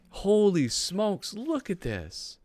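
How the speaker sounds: slow, high drawn-out amazement; high-pitched, lingering astonishment; awestruck. Real, not acted. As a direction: stretch each word out in wonder. Marvels, swapping out the swear word.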